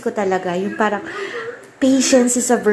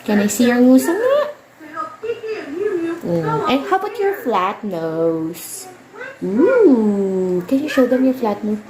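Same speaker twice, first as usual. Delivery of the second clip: playful mom voice; 5 semitones higher than usual. Real, not acted. Mother teasing daughter about her flat nose, playful.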